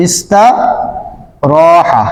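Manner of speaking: ইস্তারাহা stretched very slowly syllable by syllable; drawn-out demo. Shown as a demonstration. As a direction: draw out ইস্তারাহা as slow as you can. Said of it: "মুখে কিন্তু কিছুটা বাজে".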